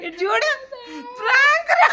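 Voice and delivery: high-pitched, bursting into laughter; laughing prank reveal. Real, not acted. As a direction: crack up while telling them it's a joke.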